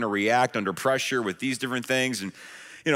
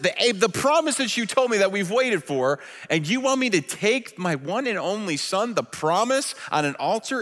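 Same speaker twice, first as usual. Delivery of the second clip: incredulous protest; 6 semitones higher than usual. Retold or imitated. Abraham's imagined outraged protest.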